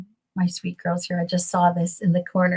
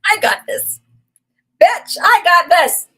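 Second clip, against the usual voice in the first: higher, louder, defiant cry of victory; triumphant shout; 9 semitones higher, 9 dB louder than usual. Acted. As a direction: belt it out like you've already won. Triumphant self-declaration after the doctor story.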